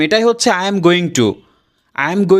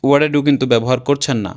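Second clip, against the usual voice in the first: ordinary delivery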